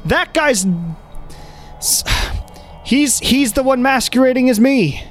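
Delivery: high-pitched insistent protest; man outside house. Acted. Man outside accusing his double, protesting. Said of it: "Ludon you have met outside the house is like".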